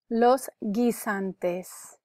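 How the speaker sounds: "Los guisantes" drawn out very slowly; very slow, separated "Los guisantes" for learners; careful pronunciation model. Shown as a demonstration. Teacher modelling vocabulary for students, very slow and careful. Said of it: "You can repeat for pronunciation".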